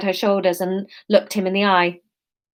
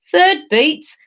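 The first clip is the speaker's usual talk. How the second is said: louder Cockney, higher; Cockney accent; 8 semitones higher, 7 dB louder than usual. Acted. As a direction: bite it out in Cockney. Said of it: "let's go Cockney".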